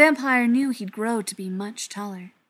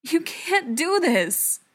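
high, slow, louder plea; plaintive; 8 semitones higher, 5 dB louder, much slower than usual. A narrator's reading. Ryan begging Brendan not to do this, plaintive. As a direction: draw it out pleadingly. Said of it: "the plaintive tone of Ryan's voice".

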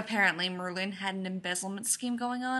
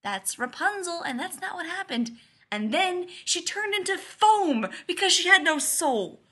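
exasperated rant, high and building louder; tirade; 7 semitones higher, 5 dB louder than usual. A narrator's reading. Leonard ranting about fairy tales to Joe, worked-up tirade. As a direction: rant with rising pitch, peaking loudly at the end. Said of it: "when he finishes his tirade".

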